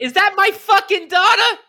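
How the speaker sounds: loud, high mock-dramatic yell in a Boston accent; hammed-up high-pitched roar; anguished movie-quote shout. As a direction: bellow it like an overwrought film scene. Shouted Mystic River movie line quote.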